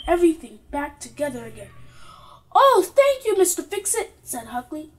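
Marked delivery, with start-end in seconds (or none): 2.5-4.1 high, louder grateful thanks; hammed-up gratitude; delighted thanks. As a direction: thank him with glee. Child thanks fixer gladly.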